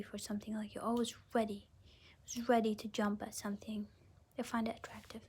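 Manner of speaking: hushed unvoiced whisper. Tarot reader whispering to the viewer. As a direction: breathe it like a secret.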